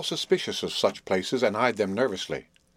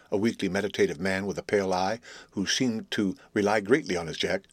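plain reading voice

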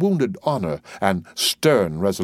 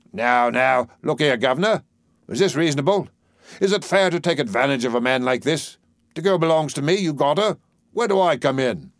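higher, aggrieved Cockney dustman; protesting dustman; 6 semitones higher, 3 dB louder than usual. Acted. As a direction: plead your case as the wronged dustman. Doolittle protesting to Higgins.

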